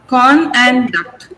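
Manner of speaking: two syllables set apart slowly; teacher slowly splitting CON from DUCT. Shown as a demonstration. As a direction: break CON and DUCT into slow, separate beats. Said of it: "These are the two syllables".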